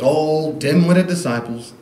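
hammed-up preacher scorn; mocking preacher. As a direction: scoff at the disciples from the pulpit. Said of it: "And that's when the preacher goes".

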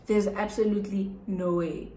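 ordinary talk

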